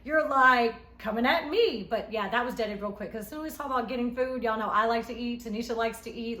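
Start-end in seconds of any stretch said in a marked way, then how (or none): 0.0-1.9 much higher and louder, then slowing down; indignant retort. Retold or imitated. Scolding Tanisha for turning on her, mock outrage. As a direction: act out an outraged comeback, stretching the end. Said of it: "I'm like".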